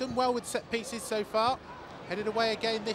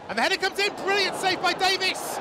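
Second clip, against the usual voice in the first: excited; 6 semitones higher than usual. Real, not acted. Header and save, live call.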